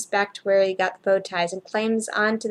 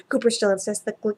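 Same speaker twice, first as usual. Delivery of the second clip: ordinary delivery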